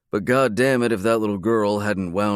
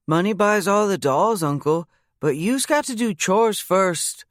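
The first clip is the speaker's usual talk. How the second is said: put-on high, bossy small child laying down rules; little girl voice; 12 semitones higher, 3 dB louder than usual. Bailey telling her uncle he must do chores first, in a high child voice. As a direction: play a little girl lecturing an adult.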